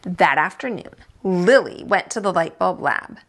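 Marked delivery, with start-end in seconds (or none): none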